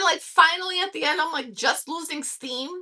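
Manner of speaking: ordinary delivery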